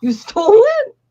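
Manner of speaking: high, loud incredulous cry of you stole it; half-laughing, stunned and loud; retelling her shocked reaction to the boyfriend; shocked disbelief. Retold or imitated.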